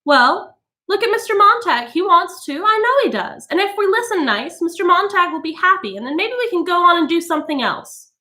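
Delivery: high, fretful coaxing voice; high nervous cajoling; nervous lady. A narrator's reading. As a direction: soothe the room while scared underneath. Mrs Bowles voiced.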